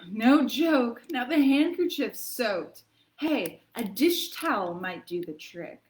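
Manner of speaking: hammed-up reading of a sniffly poem; milk every sneeze for the kids; dramatic poem reading. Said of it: "lends itself to a pretty dramatic reading".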